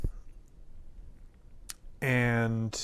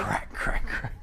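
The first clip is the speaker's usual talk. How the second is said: crack sounds. Retold or imitated. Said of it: "imitating deer hooves cracking".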